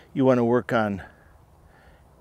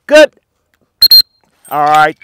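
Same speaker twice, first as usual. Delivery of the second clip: bright, much higher, louder praise; high happy praise; 5 semitones higher, 18 dB louder than usual. Real, not acted. Trainer praising retriever's good work, high excited praise. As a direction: cheer the dog on, high and loud.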